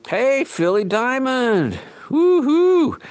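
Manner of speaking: high, loud, drawn-out greeting; cheery shouted hello; excited call-out. Real, not acted. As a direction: sing out a big hello to a viewer. Calling out greeting to viewer.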